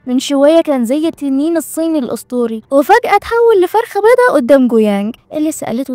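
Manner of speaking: usual speaking voice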